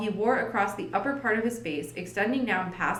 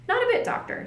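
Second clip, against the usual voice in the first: eager insistence. Acted. Holmes insists Watson stays.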